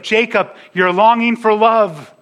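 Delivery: high-pitched, urgent mock shout of appeal; imagined shout. Preacher calling to Jacob about Rachel, urgent imagined shouting. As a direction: call out as if to talk sense into him. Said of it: "we want to shout to Jacob".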